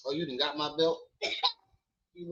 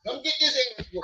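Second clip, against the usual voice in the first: mock threat; 11 semitones higher, faster than usual. Playfully threatening his kid.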